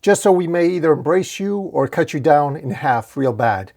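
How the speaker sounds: high grand call, easing toward the end; yelling. A narrator's reading. Wizard hailing the giant tree, yelling up. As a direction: hail someone far above, stretching the opening.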